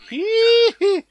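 very high, loud squealed giggle; high-pitched gleeful squeal. Real, not acted. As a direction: let out a squeaky "hiii-hi".

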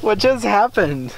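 slow amazed question. Real, not acted. After laughing fit.

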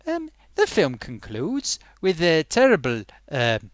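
normal talking voice

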